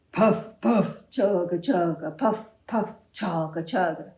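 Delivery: chugging engine sounds; tractor engine noise. Acted. Storyteller voices the cow chugging like a tractor. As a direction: puff and chug like a tractor. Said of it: "the cow looked at him and went".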